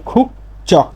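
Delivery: খুক চক in two separate beats, voiceless ch opening চক; corrected ch reading. Shown as a demonstration. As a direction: say খুক চক with ch in place of j. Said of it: "তাহলে এর উচ্চারণটা হবে".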